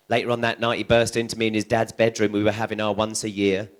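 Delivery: ordinary talk, nothing marked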